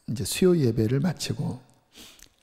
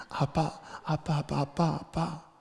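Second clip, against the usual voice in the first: put-on soft childlike call; 3 semitones higher, 7 dB quieter than usual. Shown as a demonstration. Repeats child's cry to God.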